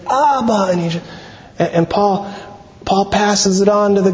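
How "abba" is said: mild imitated cry to God, pitched up; a restrained, raised-pitch plea; crying out in prayer. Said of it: "he would cry out".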